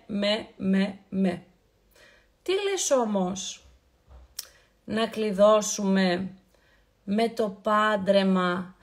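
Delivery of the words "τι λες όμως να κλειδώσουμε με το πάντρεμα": slow teasing. Acted. Slowed teasing question to viewers.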